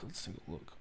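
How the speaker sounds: hushed, voiceless murmur; whisper. Real, not acted. Host whispering to himself before the last topic, whisper. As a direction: repeat it in a breathy whisper to yourself.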